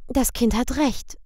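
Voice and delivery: light nanny voice. A narrator's reading. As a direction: speak up like a fussy nanny. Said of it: "sagte das Kindermädchen".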